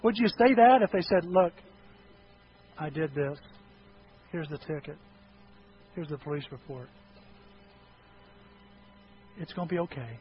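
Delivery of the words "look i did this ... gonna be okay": softer, gentle and steady, owning up quietly; calm confession. Pretend. Teen confessing to parents, quiet and reassuring. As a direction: lay the facts out softly as if reassuring.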